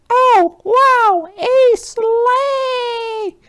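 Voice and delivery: very high, loud squeal of delight; excited little girl. Acted. A little girl finds Little Red and squeals very high and loud. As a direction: squeal like a thrilled little kid.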